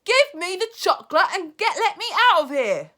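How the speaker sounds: put-on high, loud boy with a laugh; shouting to get out; panicky demand. Bruno demanding release, high and loud. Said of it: "Bruno demanding to leave".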